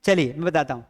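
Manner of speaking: ordinary talk, nothing marked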